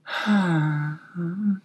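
small high sigh; deep sigh. A narrator's reading. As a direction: sigh like a tiny creature. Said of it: "The little voice sighed deeply".

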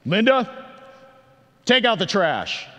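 loud, high commanding order; barking general. Retold or imitated. Pastor mocking his own bossy order to wife Linda. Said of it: "I feel like that general".

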